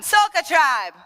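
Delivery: high, loud shout of "Soca Tribe"; loud crowd call. Shown as a demonstration. Dance leader calls the crowd's response, shouting. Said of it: "One more time".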